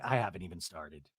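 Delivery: low, soft muttered reply; retold deadpan daughter. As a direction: drop pitch and volume, imitating. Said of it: "And I was like".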